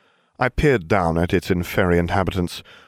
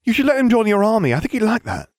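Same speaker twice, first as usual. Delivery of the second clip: very high, whiny plea; whining; 4 dB louder than usual. A narrator's reading. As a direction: wheedle in a squeaky, lovesick whine. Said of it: "Ron whined".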